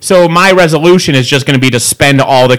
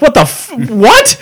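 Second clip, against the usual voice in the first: mock disbelief; 10 semitones higher than usual. Retold outrage at supervisor.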